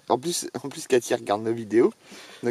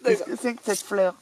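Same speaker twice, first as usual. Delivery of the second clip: hammed-up English accent on «Qu'est-ce que c'est que cette fleur?», pitched higher; 7 semitones higher than usual. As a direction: play the Englishman puzzling over the flower. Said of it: "C'est un Anglais".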